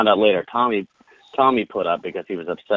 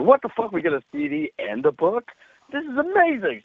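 high-pitched, giddy disbelief; retold excitement; 12 semitones higher than usual. Friends reacting to free CD and book, excited disbelief. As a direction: act out thrilled astonishment, pitch leaping up. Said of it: "So we were so excited".

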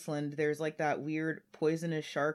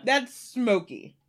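slow, hot-mouthed reaction; talking through a burning mouth; mouth burning from spicy food; 5 semitones higher, 6 dB louder, much slower than usual. Real, not acted. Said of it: "a fire in my mouth".

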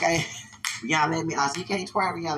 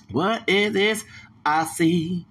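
usual speaking voice

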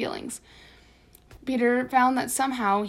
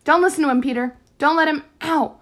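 loud, higher cry of warning; urgent warning; 5 semitones higher, 8 dB louder than usual. A narrator's reading. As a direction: call out urgently until pain cuts it off. Molly warns Peter, gets hurt.

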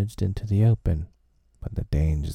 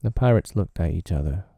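usual speaking voice

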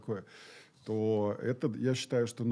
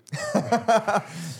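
bright laugh; 8 semitones higher, 5 dB louder than usual. Real, not acted. Laughs at being the 31st hour.

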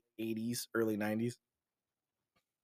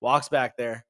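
usual speaking voice

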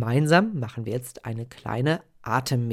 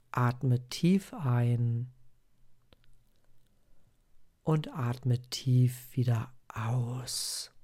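guided meditation. Acted. Leads a breathing meditation.

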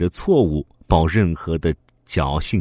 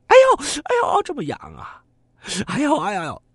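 itchy wailing. Acted. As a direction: cry out from unbearable itch. Thief's itching gets worse. (痒得尖声直叫)

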